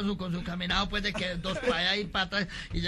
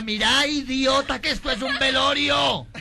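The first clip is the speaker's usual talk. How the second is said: higher, louder, slow indignant scolding; mock angry scold; 6 semitones higher, 5 dB louder, slower than usual. Lady at the wake scolds the drunk, indignant and loud. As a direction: tell him off, outraged.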